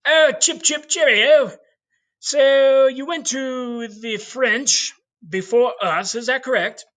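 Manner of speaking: high, slow put-on British accent; mock posh English. Teacher voicing the English court questioning Columbus, high mock British accent. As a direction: ham up an upper-class British twit. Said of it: "they ask him".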